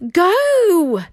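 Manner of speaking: high, loud yelled command. A narrator's reading. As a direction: bark it out at full force. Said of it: "yelled Hiccup".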